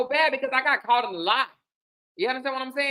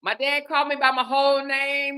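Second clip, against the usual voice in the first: usual speaking voice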